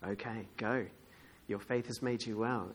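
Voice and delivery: soft, low, calm release; hushed, low reassurance; Jesus speaking gently. Acted. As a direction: grant it quietly, without fuss. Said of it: "And Jesus says".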